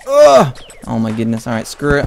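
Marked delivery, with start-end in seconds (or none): 0.1-0.6 high, loud burst of "ugh"; startled ugh. Real, not acted. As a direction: yelp out a sudden ugh. Startled yell while playing.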